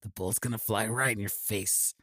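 low, hushed tough-guy impression; quietly menacing gunslinger, kept low; Clint Eastwood. Squinting gunslinger threatens his therapist, low quiet impression. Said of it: "Clint Eastwood".

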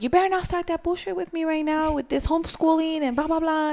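high-pitched retold telling-off; mock parental scolding. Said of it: "once I raise my voice".

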